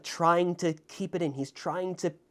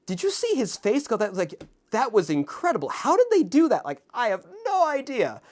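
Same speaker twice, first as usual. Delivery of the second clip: excited retelling; 8 semitones higher, 4 dB louder than usual. Retold or imitated. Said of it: "I mentioned that to friends afterwards".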